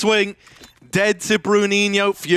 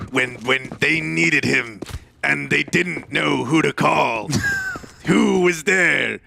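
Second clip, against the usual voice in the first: movie trailer voice; slower than usual. Pretend. Dramatic hype intro for the player.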